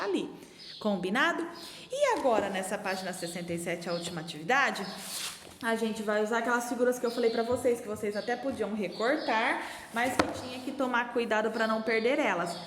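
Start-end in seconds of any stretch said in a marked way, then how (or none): none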